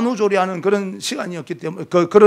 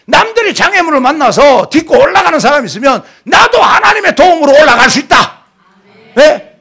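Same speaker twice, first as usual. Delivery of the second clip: loud, high impassioned sermon voice; full-force revival preaching; fiery preaching; 6 semitones higher, 7 dB louder than usual. Acted. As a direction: preach it hard, pushing the crowd.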